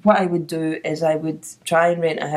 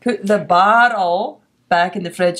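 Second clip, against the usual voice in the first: slow, exaggerated American "barrel" for bottle; mock American accent. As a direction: spell it out slowly in a put-on American accent. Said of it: "not understanding my accent".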